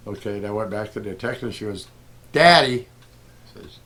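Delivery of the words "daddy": higher, louder call of Daddy. Retold or imitated. Daughter calling dad over to a rock, louder and higher. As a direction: imitate the kid calling up the hill.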